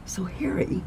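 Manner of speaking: hushed conspiratorial whisper; whispered tease before the big reveal. Acted. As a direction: lean in and breathe it secretly. Conspiratorial reveal to her boss.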